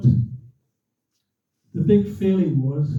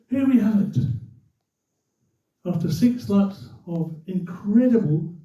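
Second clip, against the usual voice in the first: radio commentator; 3 semitones higher than usual. Retold or imitated. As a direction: imitate a commentator stretching out the suspense. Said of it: "He's the radio commentator".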